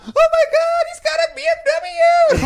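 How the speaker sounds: very high, loud squeal; high falsetto gasp of delight; mock excited woman. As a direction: shriek it like an amazed lady. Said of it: "the woman would be like".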